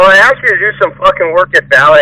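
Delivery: ordinary talk, nothing marked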